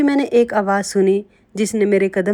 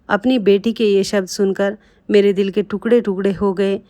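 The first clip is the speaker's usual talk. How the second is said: ordinary delivery, nothing marked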